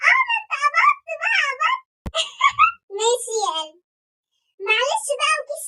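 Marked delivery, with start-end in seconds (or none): 0.1-1.8 high, loud delighted shriek; gleeful squeal. Acted. Daughter squeals that dad got paid. 2.0-2.9 shrill delighted laugh; high giggle. Acted. Daughter laughs at payday.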